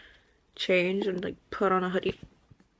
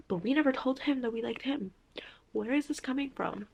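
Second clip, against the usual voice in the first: whisper; 4 semitones higher, 7 dB quieter than usual. Real, not acted. Indignant whispered protest.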